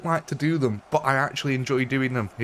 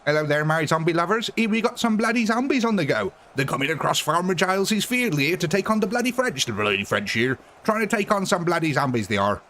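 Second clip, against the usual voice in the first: put-on West Country accent; 6 semitones higher than usual. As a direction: commentate like a West Country farmer. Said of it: "West Country accent".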